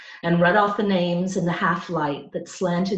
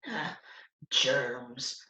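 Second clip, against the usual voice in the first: scornful; 5 semitones lower, 7 dB quieter than usual. A narrator's reading. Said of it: "Tucker said scornfully".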